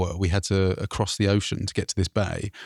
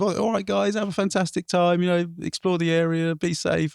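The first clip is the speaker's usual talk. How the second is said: patrol officer; 7 semitones higher than usual. Retold or imitated.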